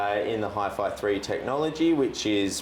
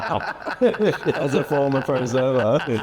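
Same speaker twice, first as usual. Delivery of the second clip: laughing; 4 semitones higher, 6 dB louder, slower than usual. Real, not acted. Teasing, laughing loudly.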